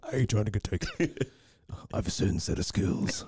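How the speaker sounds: lightly mimicked movie tough guy; Taken movie hero. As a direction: quote the film with a hushed, mock-menacing ending. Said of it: "of the movie Taken".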